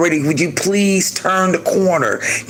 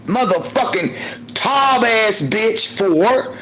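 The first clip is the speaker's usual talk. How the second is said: scolding myself; 7 semitones higher than usual. Retold or imitated. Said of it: "I just had to check myself".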